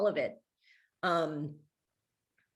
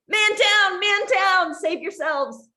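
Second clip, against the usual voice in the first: high, loud comic alarm cry; mock scream; 11 semitones higher, 5 dB louder, slower than usual. Stuck under her mother, screaming at Amy for help. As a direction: yell it like a soldier going down. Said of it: "I'm screaming at Amy".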